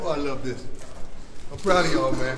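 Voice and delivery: rousing coach voice to team; halftime pep speech. Real, not acted. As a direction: fire up the team.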